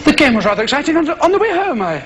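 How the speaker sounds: affected English broadcaster, mostly low and quick; Nigel, radio preacher. Acted. Nigel telling listeners of his match, affected preacherly fashion. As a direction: parody a patronising religious radio talk. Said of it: "tell you all about God in this strange fashion".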